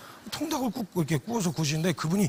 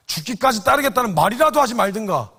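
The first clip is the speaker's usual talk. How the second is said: accusing louder; 5 semitones higher, 9 dB louder than usual. Retold or imitated. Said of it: "소리 질렀을 거예요".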